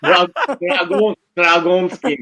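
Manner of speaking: slow, hammed-up Russian accent on dragon. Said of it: "sounds Russian".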